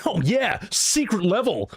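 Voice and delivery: hammed-up movie gamer cheering at the screen; mock movie gamer. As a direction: play the cheesy fake gamer from films. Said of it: "how movies portray gamers".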